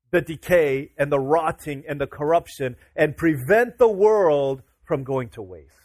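measured sermon delivery rising in force; slow preaching. Acted. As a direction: lean into each phrase like a pulpit climax. Preacher builds a point.

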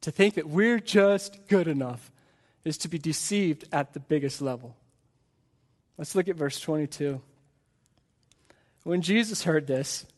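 normal talking voice

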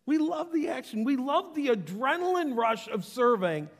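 high, loud, thrilled delivery; fired-up enthusiasm. Acted. Preacher voices our love of serving, loud and excited. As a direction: shout it on an adrenaline high.

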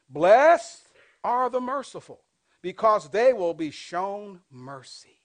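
loud, slow preaching read; booming, slow scripture read. Acted. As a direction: proclaim the verse to the whole church. Reads scripture aloud to congregation.

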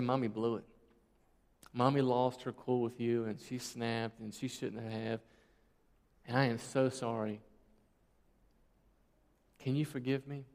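quiet, imitated motherly sorry; gentle apology. Retold gentle apology to child.

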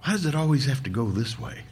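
soft, sighed complaint; sighing. A narrator's reading. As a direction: say it quietly on a weary sigh. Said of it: "he sighed and he said".

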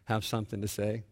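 low, hushed re-enacted plea to God; praying under breath. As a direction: pray quietly to yourself, as if retelling it. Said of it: "praying under my breath".

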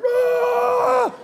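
very high loud mock yell; imitated grunt yell. Mimicking the guy at the gym: loud mock strain yell. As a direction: ham up the squatter's grunt.

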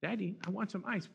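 put-on soft, whiny little girl begging; whining child. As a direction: plead like a small kid nagging for ice. Four-year-old demanding ice.

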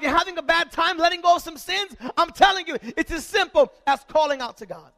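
fiery pulpit exhortation; full-force preaching. Acted. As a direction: preach it to the back row. Preaching the storm passage.

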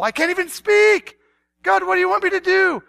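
high, exasperated pleading; acted flustered objection; protesting. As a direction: argue back in helpless protest. Acts out protesting prophet arguing.

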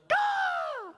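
high, drawn-out crow call; very high mock bird cry; imitated crow caw. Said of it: "And I'll be like".

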